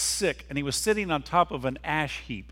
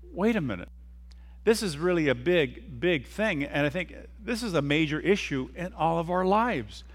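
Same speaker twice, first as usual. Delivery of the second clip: ordinary delivery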